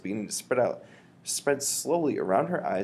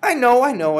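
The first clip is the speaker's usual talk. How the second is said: high, loud frustrated cry; the boy cries out in protest; much louder, higher frantic outcry; cried out; 14 dB louder than usual. Acted. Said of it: "I cried".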